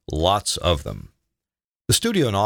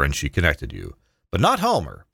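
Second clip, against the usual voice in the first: ordinary delivery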